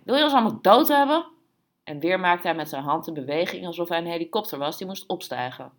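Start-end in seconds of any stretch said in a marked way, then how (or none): 0.0-1.2 high, fast, loud outcry; called out. A narrator's reading. Man at the door berates the bar, shouting. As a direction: shout it indignantly, at speed. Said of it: "riep hij".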